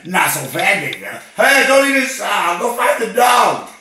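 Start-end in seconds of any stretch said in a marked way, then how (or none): 1.4-3.7 shouting orders. Acted. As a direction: yell across the room at the wife. Caller shouting at his wife.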